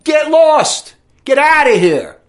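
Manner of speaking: high, loud dismissive bark; furious get-out yell; angry shout. Real, not acted. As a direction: shout them off. Telling refugees off, shouting.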